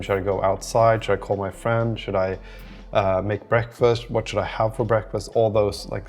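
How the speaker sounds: ordinary delivery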